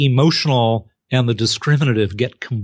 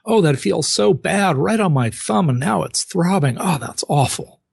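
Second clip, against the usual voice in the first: high, pained cries of a hurt thumb; mock wail of pain, much higher; pained cry; 8 semitones higher than usual. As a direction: yelp like you just hit your thumb. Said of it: "You would call out".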